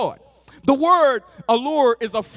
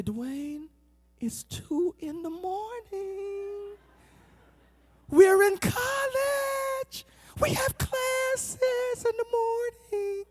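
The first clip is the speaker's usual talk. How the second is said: high, very slow drawn-out complaint; imitated sleepy girlfriend; 10 semitones higher, 5 dB quieter, slower than usual. Girlfriend answers his late-night call, imitated sleepy protest. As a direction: stretch each word in a weary high voice. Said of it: "She would say".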